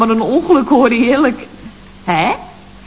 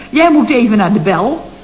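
usual speaking voice

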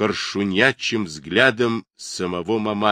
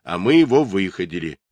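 usual speaking voice

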